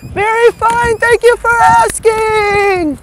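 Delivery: the pinwheel answering politely, in a high slow voice. Pretend. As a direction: squeak out a prim reply as the spinning toy.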